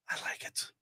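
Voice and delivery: breathy whisper to himself. Real, not acted. Streamer whispering his delight at the news. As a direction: say it under your breath, pleased.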